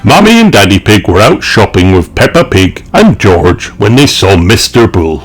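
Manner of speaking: usual speaking voice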